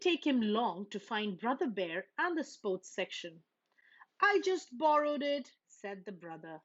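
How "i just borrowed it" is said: a hint of a protesting young cub; higher and louder, brushing it off; defensive cub. Brother excusing himself to Papa, lightly defensive.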